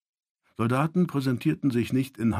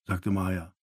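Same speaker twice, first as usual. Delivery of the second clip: ordinary delivery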